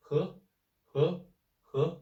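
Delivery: he rising like a question; rising second tone. Shown as a demonstration. Teacher showing the second tone on he. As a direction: lift each he upward. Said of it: "tone is like question".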